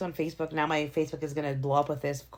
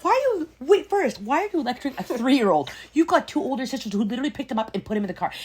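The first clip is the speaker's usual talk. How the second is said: higher, louder indignant outburst; indignant rant; 7 semitones higher, 5 dB louder than usual. Retold or imitated. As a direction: rail at the mother in disbelief. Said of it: "I'm like".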